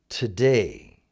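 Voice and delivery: "Today" with the weight on DAY; stress on DAY. Shown as a demonstration. Teacher repeats the right emphasis for learners, "Today" stressed on DAY. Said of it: "with day being emphasized".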